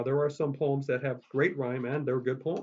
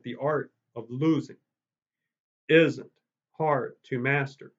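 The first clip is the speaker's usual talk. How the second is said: "isn't" punched much louder, slow heavy beats; artificial meter; 3 dB louder, slower than usual. Shown as a demonstration. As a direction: hammer "isn't" to keep the meter. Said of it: "I went".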